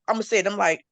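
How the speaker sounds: ordinary talk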